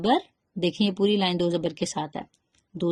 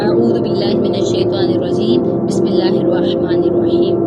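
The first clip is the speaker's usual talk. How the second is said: loud, measured recitation of the opening verses; Quranic recitation; 9 dB louder than usual. Real, not acted. Teacher recites before the students repeat, loud chanted recitation.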